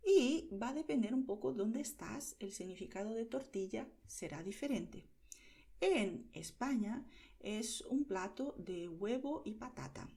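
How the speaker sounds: ordinary talk, nothing marked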